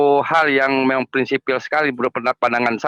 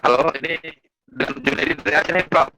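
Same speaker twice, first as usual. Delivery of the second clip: breaking up. Real, not acted. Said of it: "suaranya putus-putus".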